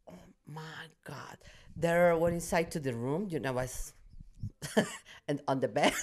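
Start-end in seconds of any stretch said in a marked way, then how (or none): 0.1-1.4 breathless awed whisper. Retold or imitated. Crew whispering in awe at the dying scene. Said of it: "and they were like".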